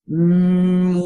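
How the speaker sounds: steady resonant hum; humming in the mask. Shown as a demonstration. Coach showing the humming exercise. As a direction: hum forward in the face. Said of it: "by humming like this".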